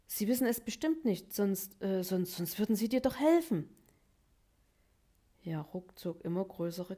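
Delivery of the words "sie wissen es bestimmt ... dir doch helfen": agitated insisting. A narrator's reading. Habi urges Jaruk, agitated.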